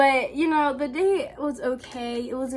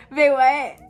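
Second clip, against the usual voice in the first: "Bae, what?" said again, louder and falling, in disbelief; louder shocked repeat; 9 dB louder than usual. Retold or imitated. Reacting to his creepy Snapchat message, louder incredulous echo. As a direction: hit the "Bae" harder the second time, mock outrage.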